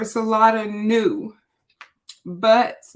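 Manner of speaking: usual speaking voice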